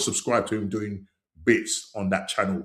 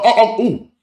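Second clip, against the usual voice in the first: high, loud rising oh-oh-ooh at a near miss; match-watching yelp; excited ooh; 11 semitones higher, 8 dB louder, faster than usual. Real, not acted. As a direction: gasp out at the chance. Reacting live to the match.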